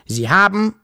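rasping police chief. A narrator's reading. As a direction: open an accusation with some authority. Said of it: "schnarrte der Polizeichef". (leicht schnarrender Polizeichef)

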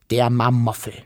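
plain reading voice

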